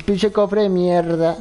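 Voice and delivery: lightly put-on Cuban accent, [name] cursing at a chest. Asked to do Cuban [name], curses the chest in a light Cuban accent. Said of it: "Haz la voz de [name] cubano".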